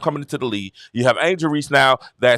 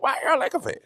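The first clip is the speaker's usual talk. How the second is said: put-on high, puzzled young voice; confused kid; 10 semitones higher, faster than usual. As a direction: sound like a kid who just doesn't get it. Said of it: "I'm like".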